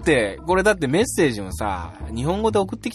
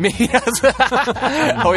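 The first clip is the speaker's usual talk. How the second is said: laughing mock echo; 9 semitones higher, 5 dB louder, much slower than usual. Real, not acted. Mocking repeat while laughing.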